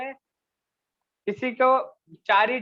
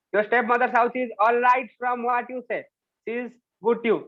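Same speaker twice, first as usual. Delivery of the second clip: slow, careful read-aloud for a class; slow story reading; 2 semitones higher, 4 dB louder, much slower than usual. Acted. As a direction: read each word out slowly for students. Teacher reads story slowly aloud. (कक्षा के लिए धीरे-धीरे कहानी पढ़ना)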